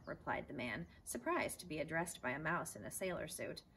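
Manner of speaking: ordinary delivery, nothing marked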